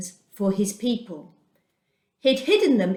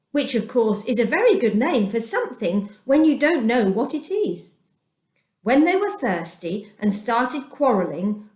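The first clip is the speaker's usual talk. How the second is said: ordinary delivery, nothing marked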